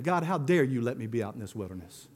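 low, subdued mock grumble; understated accusing grumble, dropped in pitch and volume; grumbling complaint. Grumbler blaming God for the wilderness, mild grumbling complaint. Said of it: "Grumbling complaints".

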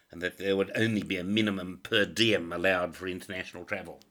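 ordinary talk, nothing marked

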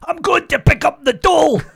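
loud, high shouted Scottish caricature; mock Scottish accent. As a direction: holler it in a hammed-up Scottish accent. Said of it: "people only ever do a Scottish accent".

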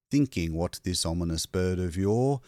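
ordinary delivery, nothing marked

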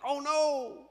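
a high, dismayed gasp of realization; the king sees the trap he has walked into. Retold or imitated. King realizes he must condemn Daniel, dismayed.

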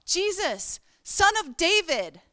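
high, loud cry for help. Retold or imitated. Beggar calling out to Jesus, loud and pleading. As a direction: call out to a passing crowd. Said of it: "he starts proclaiming out".

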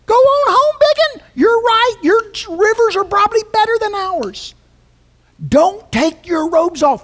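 high, loud sarcastic taunt; mock Naaman with exaggerated agreement; mocking permission. Preacher mocking Naaman's excuses, high and loud sarcasm. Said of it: "Just like Naaman".

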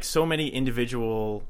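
ordinary talk

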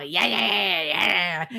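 imitated angry yapping; put-on frantic animal noises; angry dog. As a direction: yap like a snarling little creature. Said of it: "It's really angry and doing it".

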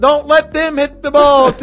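high, loud correction to the pitcher; corrective yell. Retold or imitated. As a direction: shout the fix fast, embarrassed. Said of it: "scream out".

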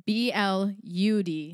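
slow, deliberate letters; spelling out. Shown as a demonstration.

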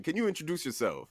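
ordinary talk

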